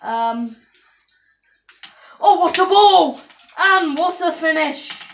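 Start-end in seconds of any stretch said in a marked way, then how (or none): 2.2-5.0 loud, high cheer of "what a ball", "what a finish"; excited commentator call. Acted. Gamer finally scores, loud thrilled commentator call. Genuine commentator-style celebration.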